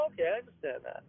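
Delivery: low, soft mimicked concession; subdued acceptance. Coworker accepting the boss's explanation, subdued and quiet. Coworker backing down after being told off.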